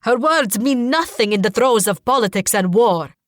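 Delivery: loud, outraged dismissal; screamed protest. A narrator's reading. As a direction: dismiss it loudly and indignantly. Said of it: "he screams".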